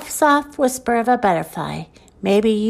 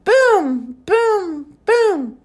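high, ringing, falling booms; high exclaimed thunder imitation; 9 semitones higher, much slower than usual. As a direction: boom like thunder, each one drawn out. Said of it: "Mister Brown makes thunder".

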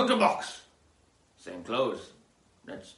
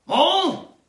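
high, loud questioning call; 16 dB louder than usual. Pretend. Host searching for sidekick Mo, high loud call. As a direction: holler for your missing sidekick. Calls out for Mo.

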